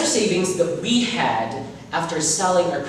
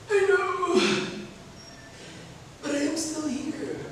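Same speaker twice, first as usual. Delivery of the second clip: faint ghostly voice; 11 semitones higher, 7 dB quieter than usual. Acted. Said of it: "Mama said".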